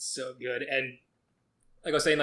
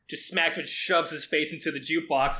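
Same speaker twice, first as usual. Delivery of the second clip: ordinary talk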